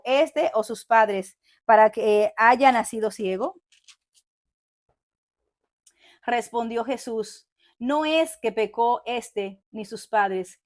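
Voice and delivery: loud, slow preaching read; scripture reading. Acted. Preacher reading John nine aloud to listeners, slow and loud. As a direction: proclaim the verses slowly to the congregation.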